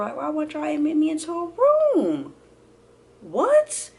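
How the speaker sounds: high-pitched baffled protest; indignant. Retold or imitated. Patient wondering why she gets no room, indignant. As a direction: rise into an incredulous "what?".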